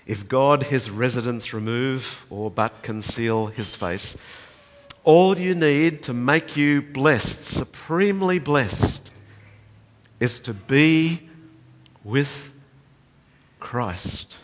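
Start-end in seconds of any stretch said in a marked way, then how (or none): 5.0-14.4 slow, high, loud build then drop; slow pulpit climax falling away; slow preaching climax. Acted. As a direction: lean on each word, land on Christ.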